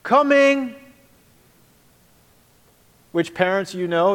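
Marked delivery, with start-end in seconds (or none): 0.0-0.8 high, loud drawn-out reply; kids answering. Retold or imitated. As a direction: call back lazily to stall. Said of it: "And we would respond".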